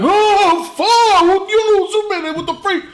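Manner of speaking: loud, high-pitched comic outburst; outraged disbelief. Acted. Son learning Mom knows Superman, loud mock outrage. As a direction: burst out loud, then drop off at the end.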